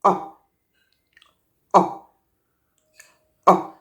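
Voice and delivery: short relaxed schwa "A" as in "above"; schwa sound. Shown as a demonstration. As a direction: say "A" as a quick neutral uh. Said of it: "The first sound of the letter A".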